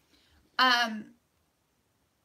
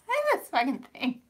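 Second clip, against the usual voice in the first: ordinary talk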